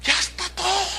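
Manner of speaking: lifted, high-pitched imitation of someone reporting back; congregant's quoted reply. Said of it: "me dice".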